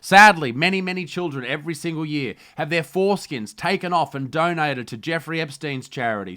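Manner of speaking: loud, high, brash parody newsreader; host a trashy news show with swagger; mock news anchor.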